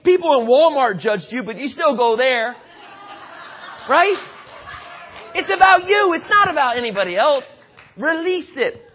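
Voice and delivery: rising, driving sermon delivery; full-force preaching. Acted. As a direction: preach it hard to the congregation.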